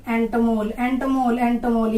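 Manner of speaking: एंटोमोल as ento-MOL, ending on the L; drilled word part. Shown as a demonstration. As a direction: repeat एंटोमोल as one joined chunk. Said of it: "यहाँ तक याद रखो". (एंटोमोल को L पर रोककर दोहराना)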